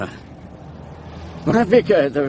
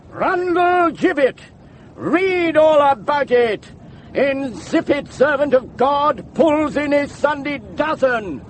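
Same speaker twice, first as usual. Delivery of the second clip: high, drawn-out street seller's call; newsboy cry; 10 semitones higher than usual. Acted. Campaigner cries headline mocking the vicar, newsboy cry.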